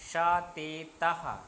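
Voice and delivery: teacher dictating the forms; slow pronoun drill. Shown as a demonstration. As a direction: spell out the pronouns slowly for the class. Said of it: "ये सर्वनाम लिखने हैं".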